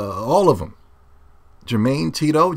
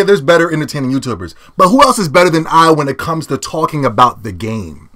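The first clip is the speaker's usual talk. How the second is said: ordinary talk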